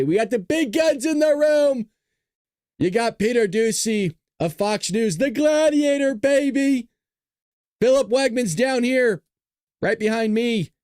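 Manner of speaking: high-pitched fighter introductions; hype announcer. Acted. Host presenting the big guns in the room, hype announcer. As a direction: announce them like gladiators entering the ring.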